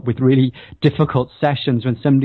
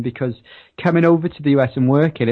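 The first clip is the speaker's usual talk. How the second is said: usual speaking voice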